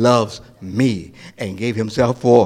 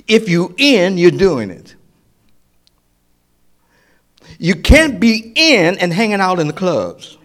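loud, high preaching cry; preaching at full force; 7 semitones higher, 6 dB louder than usual. Acted. Preacher warning the church about clubs, loud rising preaching. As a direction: preach it out over the congregation.

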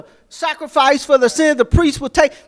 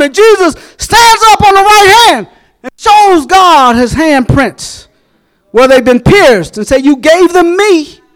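high, loud, rolling preacher cadence; fervent Sunday sermon at full volume; full-force preaching; 6 semitones higher, 8 dB louder than usual. Real, not acted. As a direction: preach it out to the congregation.

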